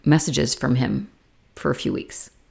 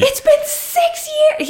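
much higher, near-crying shout; losing her mind; slower than usual. Retold or imitated. Carrie explodes at Big in the street, crying shout. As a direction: scream it through tears at him. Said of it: "she fucking loses her mind".

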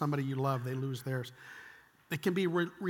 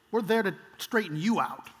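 normal talking voice

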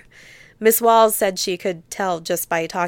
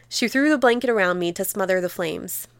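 ordinary delivery

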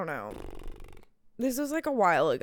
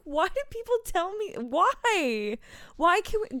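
high-pitched comic pleading; exasperated complaint; 9 semitones higher than usual. Retold or imitated. Asking why people share dead dog stories, comic exasperation. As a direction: wail the question in mock despair.

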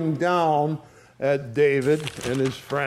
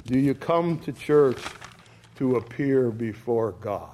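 softer, gentle pastoral asking; soft, earnest question; 6 semitones lower, 4 dB quieter than usual. Real, not acted. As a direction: lean in and ask them gently. Pastor softly asks congregation.

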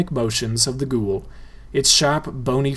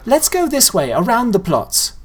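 put-on much higher girl's voice, leading off; bossy girl; 10 semitones higher than usual. A narrator's reading. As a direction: take charge like a bossy little girl. Girl leads the way.

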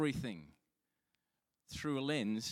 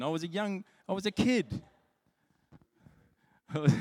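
much higher and louder, laughing excuse; defensive protest; 8 semitones higher, 8 dB louder than usual. Real, not acted. Pastor to mortified wife Susan, loud defensive excuse. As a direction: plead your case to your wife with a grin.

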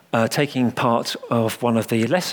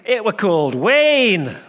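put-on high, louder little boy's voice; piping Yorkshire child; 8 semitones higher, 3 dB louder than usual. A narrator's reading. Little boy eagerly answering the vicar, piping. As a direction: pipe up like an eager little lad. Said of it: "the child told him".

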